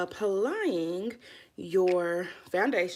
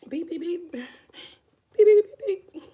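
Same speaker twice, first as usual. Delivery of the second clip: high mock beeps, the third pair louder; imitated beeping; 10 semitones higher, 10 dB louder than usual. Vlogger imitates a timer beeping to start. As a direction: beep like a timer going off.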